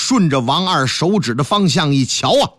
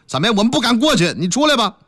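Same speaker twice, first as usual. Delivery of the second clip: ordinary talk, nothing marked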